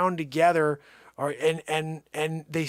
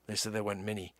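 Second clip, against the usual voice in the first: usual speaking voice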